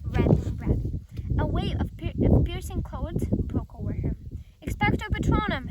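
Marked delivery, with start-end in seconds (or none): 4.7-5.7 yelled spell. A narrator's reading. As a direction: yell the spell with all his might. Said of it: "Harry yelled".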